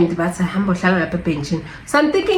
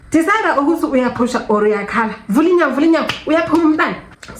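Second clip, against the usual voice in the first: urgent nurse; 8 semitones higher, faster than usual. Retold or imitated. Nurse urging her on.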